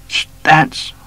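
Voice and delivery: high, loud stop order; commanding shout. A narrator's reading. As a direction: halt him with one word. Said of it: "råbte han".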